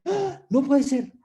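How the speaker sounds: grito agudo de sorpresa ante el espejo; susto fingido. Retold or imitated. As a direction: exclamar horrorizado, en voz mucho más alta. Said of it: "se mira al espejo y dice". (se mira al espejo y se asusta, grito agudo)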